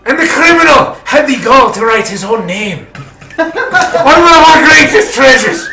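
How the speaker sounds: put-on the prince in a Spanish accent, rising to a loud furious roar; bellowing prince. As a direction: address the court, building from stern to outraged. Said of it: "bellowing to the audience".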